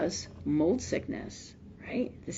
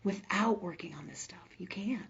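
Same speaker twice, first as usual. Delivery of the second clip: emphatic whisper; 12 dB quieter than usual. Real, not acted. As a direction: drop to an intense hushed whisper. Emphatic closing point to audience.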